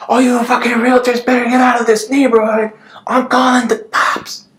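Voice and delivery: high, loud mimicked homeowner yell; irate yelling. Homeowner yelling at door-knocking realtors, mimicked angry shout. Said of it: "while he's yelling it".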